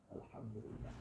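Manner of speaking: a barely voiced, broken Alhamdulillah; whispered through tears. The fisherman whispers praise as his one sardine slips back into the sea. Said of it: "I'm crying".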